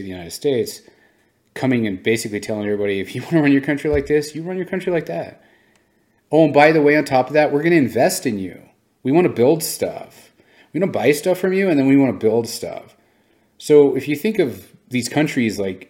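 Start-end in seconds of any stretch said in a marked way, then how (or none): 3.0-13.0 higher and louder, friendly salesman pitch; cheerful mock China. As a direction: sell it like a generous new friend. Voicing China's friendly offer.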